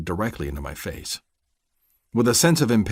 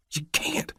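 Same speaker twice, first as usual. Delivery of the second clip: urgent hush; 9 semitones higher, 5 dB quieter than usual. Acted. Urgent warning.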